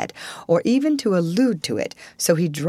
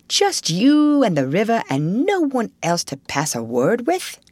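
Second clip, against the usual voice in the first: lightly voiced Mole, swooping question; doubting Mole; 4 semitones higher than usual. Mole asks Rat about loneliness, doubtful and wondering. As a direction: stretch it out, leaping up high at the end.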